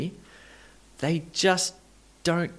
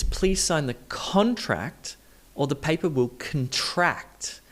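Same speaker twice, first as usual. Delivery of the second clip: CON-tract first, then con-TRACT at the end; shifted stress. Shown as a demonstration. Teacher repeats the practice sentence, shifting stress. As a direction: move the stress in "contract" from first syllable to second. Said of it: "that stress shifted from the first syllable".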